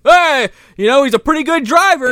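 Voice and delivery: high, loud hammed-up movie quote; exaggerated admiring character voice; mock impressed. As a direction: imitate a character amazed at the driving. Acted villain's surprised praise.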